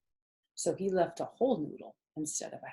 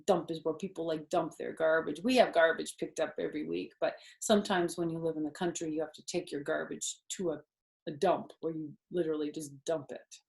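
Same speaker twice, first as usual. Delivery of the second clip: ordinary delivery, nothing marked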